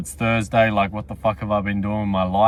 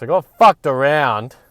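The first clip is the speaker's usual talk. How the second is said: exasperated; 3 semitones higher, 7 dB louder than usual. Real, not acted. Complaining about the order.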